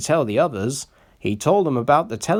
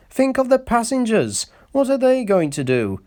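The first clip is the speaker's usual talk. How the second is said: high reproachful voice; scolding Skarloey; 12 semitones higher, 3 dB louder than usual. A narrator's reading. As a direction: press him with shame.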